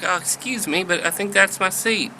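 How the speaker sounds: high-pitched Southern woman's voice; Southern belle politely objecting; Southern lady. Acted. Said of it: "She's from the South".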